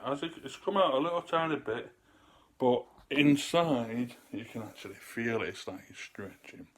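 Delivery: normal talking voice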